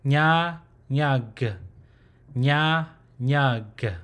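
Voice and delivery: "Nya, nyag" with NY as in canyon; open-mouthed "nya", then closed "nyag"; NY consonant. Shown as a demonstration. Teacher asking learners to remember the sound, careful NY pronunciation. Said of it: "try to remember how it's pronounced".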